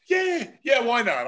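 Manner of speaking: high, loud mock-delighted yes; eager imitation. Imitating colleague accepting another little cup of chowder, eager. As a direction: jump in eagerly as the colleague, then settle.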